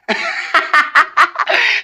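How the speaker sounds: a high, breathy burst of laughter. Real, not acted. Laughing at her own story, high burst of laughter.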